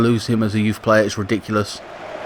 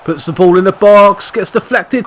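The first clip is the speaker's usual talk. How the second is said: rising, louder play-by-play call; higher, louder caller pitch; excited commentary; 7 semitones higher, 6 dB louder than usual. Real, not acted. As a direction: call the chance as it builds. Live match commentary rising.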